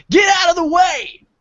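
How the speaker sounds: high, full-voiced angry yell; screaming. Angry old man yelling at a crowd in the mall, screaming. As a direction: scream it like an enraged stranger. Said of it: "he just screams".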